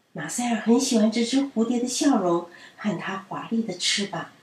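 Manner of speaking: ordinary delivery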